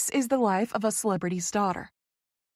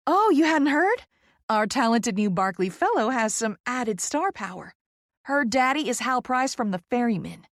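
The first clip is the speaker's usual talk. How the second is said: Poppy, effusive Southerner; 4 semitones higher than usual. Acted. Poppy revealing the celebrity father to Vicky, gushing Southern voice. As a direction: break big news in a gushing Southern voice.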